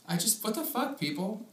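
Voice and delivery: ordinary talk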